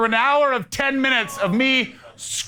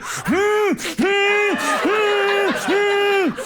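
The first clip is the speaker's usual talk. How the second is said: crying hums; 11 semitones higher, much slower than usual. Shown as a demonstration. Comedian demoing his public crying, high strained hums. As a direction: hum out sobs through held-in tears. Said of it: "the way I cry".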